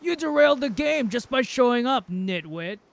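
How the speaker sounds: loud, high sneering jab at the newcomer; scornful trapper. Acted. The trapper sneers at the newcomer, loud and high. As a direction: bark it out with contempt.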